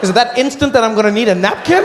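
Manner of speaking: a loud, laughing high-pitched double-take; mock disbelief. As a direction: act baffled and offended. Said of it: "I said".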